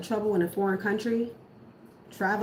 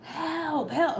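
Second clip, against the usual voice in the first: mock cry for help; 7 semitones higher than usual. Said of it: "reaching out from the pits of hell".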